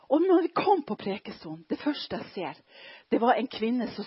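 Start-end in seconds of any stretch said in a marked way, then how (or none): none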